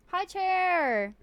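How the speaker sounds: high drawn-out hi; sing-song greeting. Real, not acted. Streamer greeting Chair in a high sing-song voice. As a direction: call out hi in a lilting voice.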